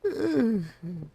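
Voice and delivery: very high put-on giggle; squeaky laugh. Laughing at his own whine, squeaky giggle. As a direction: cackle in a squeaky voice. Laughs after whiny line.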